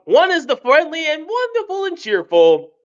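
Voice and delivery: high cheerful storybook voice; bubbly friendly Hatter; friendly Mad Hatter. Shown as a demonstration. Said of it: "two types of Mad Hatters".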